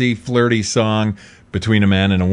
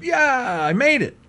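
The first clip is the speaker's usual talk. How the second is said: high, lightly voiced victory cheer; a hint of a fist-pump yeah; mock triumph; 14 semitones higher than usual. Host imitating a drafted player celebrating, with a mild high cheer. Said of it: "threw his fists in the air and said".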